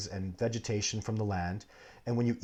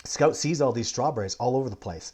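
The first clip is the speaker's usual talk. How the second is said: ordinary talk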